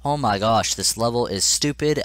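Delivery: loud, exasperated outburst; raging. Real, not acted. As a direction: vent your rage at the game. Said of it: "oh my god, I'm raging".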